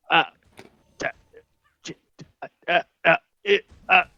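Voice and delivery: stammering god, lost for words; stuttering. Acted. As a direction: stumble and stutter on every sound. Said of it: "he just is stuttering".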